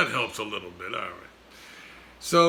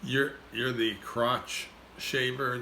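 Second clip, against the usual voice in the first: ordinary delivery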